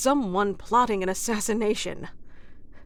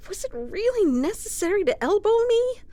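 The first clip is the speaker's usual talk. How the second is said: indignant protest; 6 semitones higher than usual. Acted. Protesting after being elbowed.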